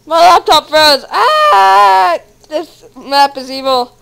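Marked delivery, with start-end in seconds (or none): 1.1-2.2 loud high scream; frustrated scream. Real, not acted. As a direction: scream at the frozen laptop. Laptop froze.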